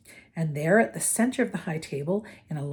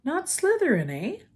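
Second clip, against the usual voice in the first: put-on higher, musing old hat weighing up a new pupil; Sorting Hat voice; 10 semitones higher than usual. A narrator's reading. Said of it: "said the small voice".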